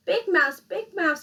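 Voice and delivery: loud, high plea; lonely little mouse calling in the night; crying out. A narrator's reading. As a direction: cry out for company. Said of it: "he cried".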